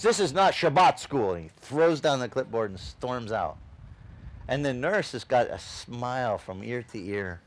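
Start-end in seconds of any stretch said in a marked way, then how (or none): 0.0-1.3 a louder, higher snap from an annoyed doctor; irritated doctor. Retold or imitated. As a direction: huff it out and throw down the clipboard. Doctor storms out angrily.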